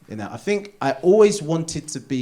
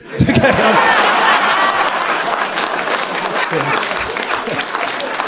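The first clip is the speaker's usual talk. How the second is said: long airy laugh; 7 semitones higher, 6 dB louder than usual. Real, not acted. Extended laughter.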